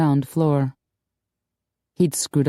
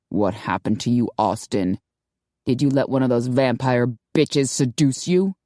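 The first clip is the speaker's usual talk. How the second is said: a slightly lower, hinted Sean, scornful; scathing commander, lightly lowered; lightly voiced gruff boss; 4 semitones lower than usual. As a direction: sneer it as the suspicious commander.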